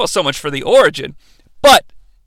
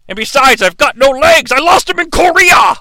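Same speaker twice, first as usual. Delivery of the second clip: building to a high shout; hammed-up aggrieved holler, climbing in pitch; mock veteran outburst; 7 semitones higher, 4 dB louder than usual. Uncle Claus excuses himself, building aggrieved shout.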